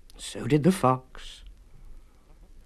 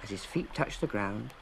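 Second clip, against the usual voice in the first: usual speaking voice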